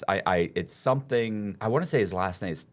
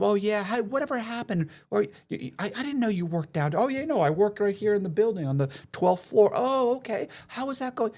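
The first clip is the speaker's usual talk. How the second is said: high, gushing fake-friend voice; overly friendly con man; 12 semitones higher than usual. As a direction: gush like you know him well. Con man chatting up mark.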